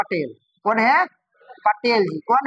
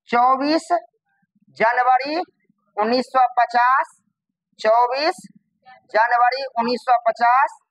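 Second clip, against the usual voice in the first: word by word with pauses; each chunk lifted at the end, then a pause; dictating. Shown as a demonstration. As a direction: spell out the date for note-takers. Teacher dictating a date to class.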